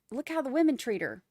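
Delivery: ordinary talk, nothing marked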